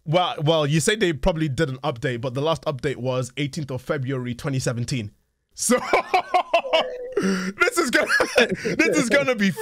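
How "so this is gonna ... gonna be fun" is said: laughing. Real, not acted. Laughing at broken old map.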